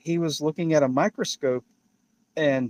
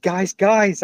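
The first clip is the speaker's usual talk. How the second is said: a raised call for attention; calling out to the men; 6 semitones higher, 6 dB louder than usual. Retold or imitated. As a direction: Barbara calls out to the guys, loud and eager. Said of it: "and so then Barbara said".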